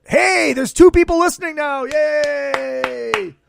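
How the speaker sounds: loud, high, real excitement; big high whoop into a long drawn-out yay; excited cheer. Real, not acted. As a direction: cheer the new arrivals, voice way up. Greets new listeners joining the stream.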